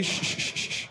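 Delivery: hushed shh to quiet the room; shushing. Real, not acted. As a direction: hush the crowd before the news. Hushing the room.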